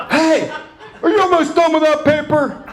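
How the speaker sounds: high, loud impatient dad; a hammed-up nagging father; pushy dad. Dad pestering his son about the paper, loud and high.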